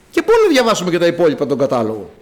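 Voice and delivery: ordinary talk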